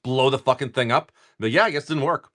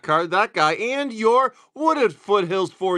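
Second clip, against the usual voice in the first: playful announcing; 8 semitones higher, 4 dB louder, slower than usual. Acted. Opening packs, playful announcing.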